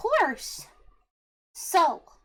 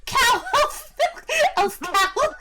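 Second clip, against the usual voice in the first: laughing; 9 semitones higher, 3 dB louder, much slower than usual. Real, not acted. Laughing at cow elf milk.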